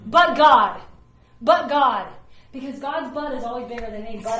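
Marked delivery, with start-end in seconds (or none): 0.1-2.1 loud, punchy refrain; emphatic but God. Real, not acted. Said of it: "shouted refrain".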